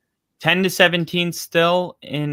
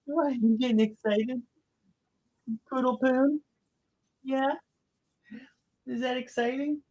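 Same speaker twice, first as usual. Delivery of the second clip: much higher and softer, cooing; high dog talk; 9 semitones higher, 3 dB quieter than usual. Real, not acted. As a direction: tease the dog in a sing-song coo. Teasing the excited dog.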